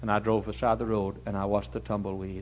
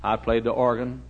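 ordinary talk

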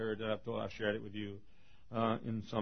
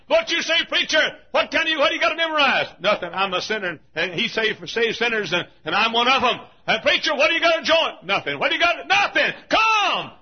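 high, loud revival exhortation; full-force preaching; 13 semitones higher, 13 dB louder than usual. Real, not acted. Preacher pressing sinners to come, shouted high. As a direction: proclaim it hard to the whole congregation.

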